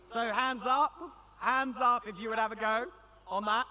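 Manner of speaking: rally the crowd to raise hands; bright call to the room. Real, not acted. Calling out to audience.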